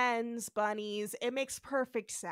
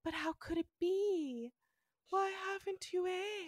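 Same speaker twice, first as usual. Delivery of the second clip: mock bewildered wonder; 7 semitones higher than usual. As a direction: ask it in hushed, dazed wonder. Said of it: "He's like".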